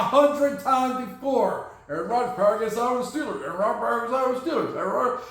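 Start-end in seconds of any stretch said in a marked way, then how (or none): 1.9-5.2 very fast, droning gabble; racing through it on a loop; mocking rapid repetition. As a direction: rattle it off like a tired old rumour. Mocking the endlessly repeated rumour.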